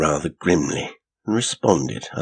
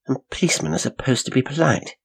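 inner thought; 8 semitones higher, faster than usual. A narrator's reading. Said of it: "she thought".

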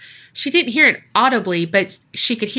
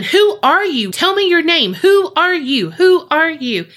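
mock yell, raised pitch; insistent, higher demands, half-shouted; retold yelling; 7 semitones higher, 4 dB louder than usual. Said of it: "and starts yelling".

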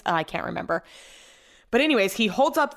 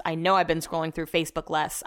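usual speaking voice

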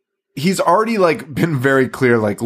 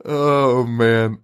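ordinary talk

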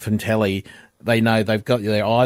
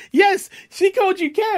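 much higher, delighted squeal; gleeful. Retold or imitated. The lady gleefully confirms the slip, much higher. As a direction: squeal it with glee. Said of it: "She goes".

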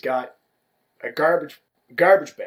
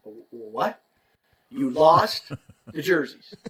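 stunned disbelief; 4 dB louder, slower than usual. Retold or imitated. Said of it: "Well, I was stunned".

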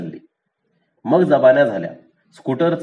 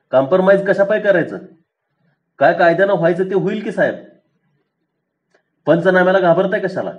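raised voice; 6 semitones higher than usual. A narrator's reading. Milkman challenging the scooter man, voice raised. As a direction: voice lifted in challenge. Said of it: "पांडानं आवाज चढवला".

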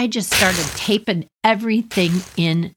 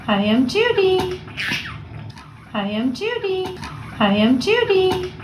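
high, sing-song voice for the bird; teaching the parakeet; 10 semitones higher than usual. Shown as a demonstration. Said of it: "So I started saying".

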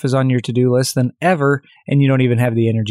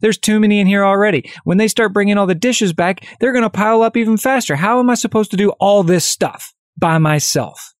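higher, mock frustrated outburst; imitating a frantic young monk overwhelmed by dishes; flipping out; 7 semitones higher than usual. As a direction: play the monk losing it, pitched up. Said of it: "He just flips out".

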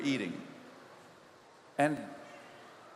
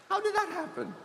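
sharply raised, wondering question; high-pitched retold curiosity; curious question; 13 semitones higher than usual. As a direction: ask it as if truly puzzled how. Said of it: "And so I was curious".

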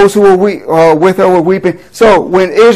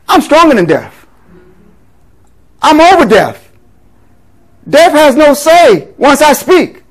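higher, emphatic voice of authority; authoritative declaration; 5 semitones higher than usual. Acted. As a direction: declare it with total command, pausing between statements. Speaking as Jesus declaring power over death.

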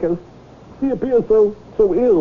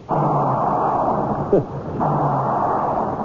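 breathy laugh. Acted. Whale refuses, he laughs.

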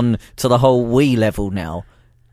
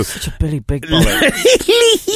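laughing; slower than usual. Real, not acted. Teasing co-host.

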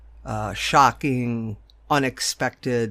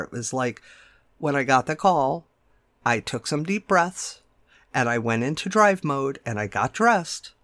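ordinary talk, nothing marked

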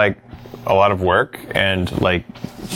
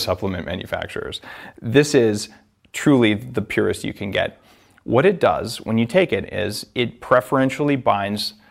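ad read. Acted. Host reads product ad.

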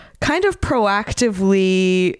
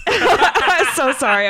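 laughing; 8 semitones higher, much slower than usual. Real, not acted. Laughs at her own slip.

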